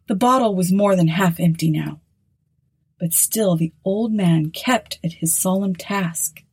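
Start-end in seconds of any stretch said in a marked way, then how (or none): none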